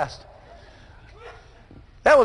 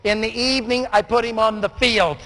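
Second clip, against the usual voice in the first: ordinary delivery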